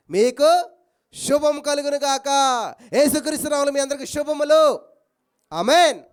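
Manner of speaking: loud, ringing blessing in Jesus' name. Acted. Preacher blessing the whole church in Jesus' name, loudly. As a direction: pronounce it over everyone. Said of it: "ఏమంటున్నాడు తెలుసా".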